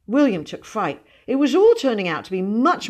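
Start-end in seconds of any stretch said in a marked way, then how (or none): none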